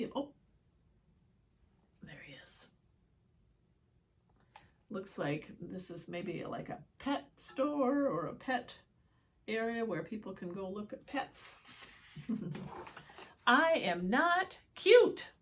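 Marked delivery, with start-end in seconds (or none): 2.0-2.7 whisper. Real, not acted. Spotting the hidden wolf. 13.5-15.3 defiant wolf. Acted. Wolf insists in story.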